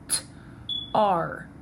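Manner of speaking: "T-R" as separate sounds, a crisp t, then ar; sounded out. Shown as a demonstration. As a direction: sound out "T-R" with a gap between. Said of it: "the sounds that would make up tar".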